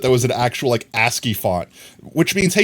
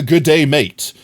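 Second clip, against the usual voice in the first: mock English accent; 5 semitones higher, 7 dB louder, slower than usual. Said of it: "English accent I could possibly rip off".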